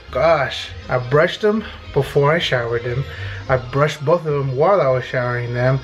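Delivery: ordinary talk, nothing marked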